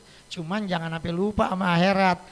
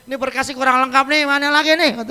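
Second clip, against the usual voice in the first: louder, flustered grumble over missing papers; exasperated fussing; 3 semitones higher, 8 dB louder than usual. Acted. Soul stuck in the heaven queue, fussing loudly and exasperated. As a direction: fuss like a clerk sent back again.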